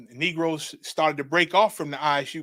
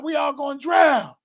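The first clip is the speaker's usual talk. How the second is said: high, loud shout of panic; loud, high alarm with a falling end; panicked shout; 10 semitones higher, 5 dB louder than usual. Retold or imitated. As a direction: yell it as the water closes over everyone. Crying out drowning in vision.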